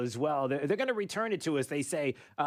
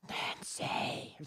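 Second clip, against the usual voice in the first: breathy whisper like the wind; windy whisper; 6 dB quieter than usual. Pretend. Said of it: "I think it would sound like the wind".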